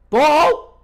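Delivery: high, loud burst of excitement cracking the voice; real cracked-voice commentator outburst; excited voice crack. Real, not acted. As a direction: shout the save so keenly your voice breaks. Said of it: "My voice had a voice crack there".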